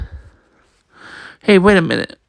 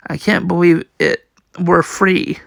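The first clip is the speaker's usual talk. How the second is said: plain reading voice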